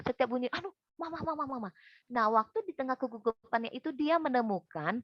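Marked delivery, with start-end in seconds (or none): 0.5-1.7 higher, softer panicky muttering; nervous fluster. Retold or imitated. Child startled by every sound as mama comes home, nervous fluster. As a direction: imitate a jittery child calling for mama. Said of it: "saking gugupnya".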